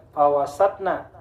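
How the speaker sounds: FAWASATNA with T running straight into 'na'; no echo between T and N in FAWASATNA; wrong, no bounce. Shown as a demonstration. Teacher warns against this reading, unbounced T. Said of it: "Jangan bapak baca".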